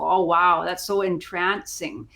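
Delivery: ordinary delivery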